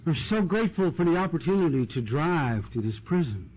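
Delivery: a lightly mocked saintly AA member; pious grateful sharer.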